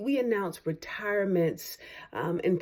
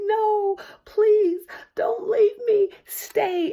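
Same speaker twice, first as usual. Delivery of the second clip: much higher, louder hammed-up pleading; begging fans; 7 dB louder, much slower than usual. Fans beg the retiring rapper to stay, in a hammed-up high wail. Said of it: "all of my fans come and say".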